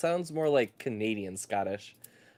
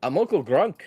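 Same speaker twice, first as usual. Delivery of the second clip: loud, higher Canadian-tinged Scottish accent; Uncle Grunk; 5 semitones higher, 9 dB louder than usual. Shown as a demonstration. D&D player's line to get into character, loud Scottish accent. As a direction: holler it in rough-and-ready Scottish. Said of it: "I can kind of do Scottish, but it sounds more like Canadian Scottish".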